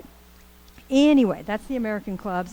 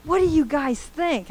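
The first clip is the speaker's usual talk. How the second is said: energetic teacher voice; 7 semitones higher than usual. Retold or imitated. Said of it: "He's like".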